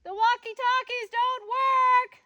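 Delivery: high, drawn-out full-force holler; top-of-lungs yell. Shown as a demonstration. Mom yelling to Sam when walkie-talkies fail, full yell. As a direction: holler it to someone over the hill. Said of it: "yelling at the top of my lungs".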